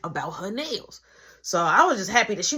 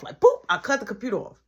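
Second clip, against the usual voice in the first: ordinary talk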